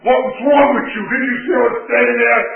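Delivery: loud, high yell at teammates after a bad play; hot-tempered player yelling at teammates; retold angry yell. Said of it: "I'd yell at my teammates".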